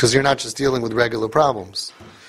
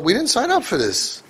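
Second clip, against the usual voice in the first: higher pitched, exasperated "we didn't sign up for this"; mock parent complaint; 9 semitones higher than usual. Rabbi imitating overwhelmed parents, mock exasperation.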